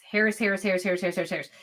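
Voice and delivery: mock endless chant, fading away; rattled-off repetition. Vlogger mimicking a feed full of one name, rattled off.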